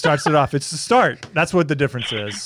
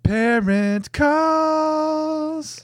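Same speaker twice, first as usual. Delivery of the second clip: very slow, much higher game-show call; drawn-out announcer title; 14 semitones higher, much slower than usual. Acted. Comedian announces the game name, very slow and much higher. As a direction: stretch the title out like a host. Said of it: "that was what you did last episode".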